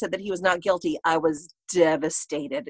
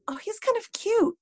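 dreamy realization; 14 semitones higher than usual. Retold or imitated. Realizing attraction, retold thought.

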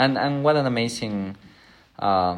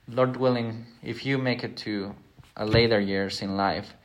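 ordinary talk

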